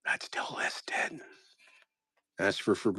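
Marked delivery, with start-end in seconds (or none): 0.1-1.2 quiet whisper, a little slow. Real, not acted. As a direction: mutter it under your breath. Whispered aside while sorting.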